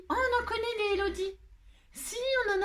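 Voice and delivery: high, quick teasing exclamation; playful high mock exclamation. Real, not acted. Teasing about all the Élodies.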